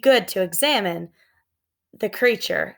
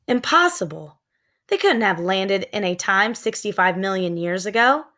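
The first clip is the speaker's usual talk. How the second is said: plain reading voice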